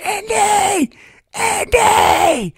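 high, strained shouted name; yelling for dog. Retold or imitated. Owner yells at drowning dog, high urgent shout. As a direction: yell out in alarm to your dog. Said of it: "And I yelled at him".

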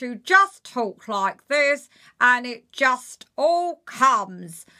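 slow it right down and grumble; serious Janet. Shown as a demonstration. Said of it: "when you wanna make her a little bit more serious".

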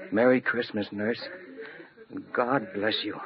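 saying it from the heart; tender inner wish. Acted. Silent heartfelt wish to nurse.

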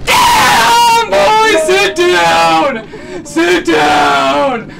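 loud gloating shout; triumphant yell. Real, not acted. Gamer gloating at a friend he just killed, loud shout. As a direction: boast over the kill. Triumphant taunt after a kill.

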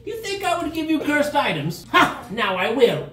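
high cackling mock-villain voice; gleeful villain. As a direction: gloat like a pantomime villain. GM voices gleeful NPC threat.

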